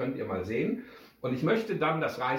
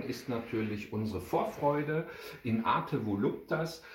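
ordinary delivery, nothing marked

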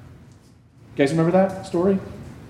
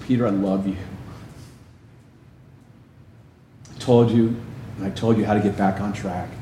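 softer, lower gentle voice; tender love; 7 semitones lower, 4 dB quieter than usual. Acted. Jesus looking at Peter after the denial, tender and soft. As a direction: speak to him with quiet love. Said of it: "I think it was".

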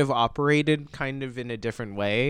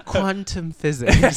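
laughing through the words; 7 semitones higher, 8 dB louder, slower than usual. Real, not acted. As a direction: chuckle it out, amused. Laughing while joking about quantum physics.

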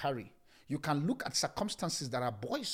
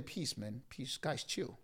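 much softer, lower easy hush; laid-back calm; 3 semitones lower, 10 dB quieter, slower than usual. Acted. Jesus telling the frightened guys to chill, soft. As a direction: tell them to chill, softly. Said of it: "that's how we say it".

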